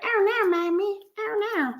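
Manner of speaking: high whiny little voice, imitating a sleepy child; high childlike imitation; Mister Carter's voice. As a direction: mimic the kid whining oh no. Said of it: "That's how Mister Carter be talking".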